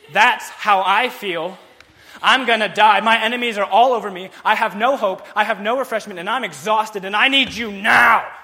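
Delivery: loud, high, pleading cry; impassioned despair. Acted. Preacher voices the psalmist's despair, loud and pleading. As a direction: cry out as if about to die.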